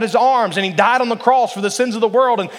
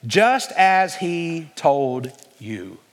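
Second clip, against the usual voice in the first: slow reading; 3 semitones lower, much slower than usual. Shown as a demonstration. Slow close of scripture quote.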